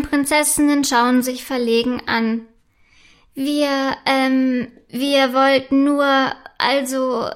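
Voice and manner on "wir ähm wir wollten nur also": halting, hesitant, caught out; stammering. A narrator's reading. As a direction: stumble over the excuse. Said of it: "stammelt Prinzessin Felicitas".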